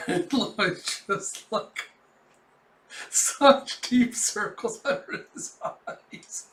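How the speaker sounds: words broken up by real laughing; talking through laughter. Real, not acted. Reader amused at exhausted Lloyd, laughing as he talks. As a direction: wheeze it out slowly through laughter.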